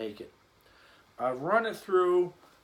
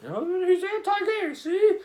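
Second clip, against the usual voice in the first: mock offended critic; 8 dB louder than usual. Imagined offended viewer mocking.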